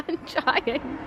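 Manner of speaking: laughing. Real, not acted. Struggling with heavy box, laughing.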